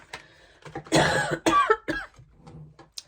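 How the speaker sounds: loud harsh coughing fit. Real, not acted. Sick vlogger to viewers, loud real coughing fit. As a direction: cough hard, real covid cough. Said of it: "you can hear I'm coughing".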